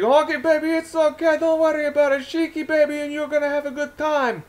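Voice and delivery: very high-pitched, carefree wrestler impression; bad Iron Sheik. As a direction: badly imitate an Iranian wrestler soothing a buddy. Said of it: "Probably doing a bad Iron Sheik".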